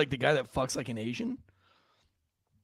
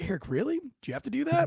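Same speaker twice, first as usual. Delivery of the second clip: high-pitched mock reproach; exasperated wife; 10 semitones higher, 3 dB quieter, faster than usual. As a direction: imitate a wife's disbelieving scolding. Wife scolding him in retelling.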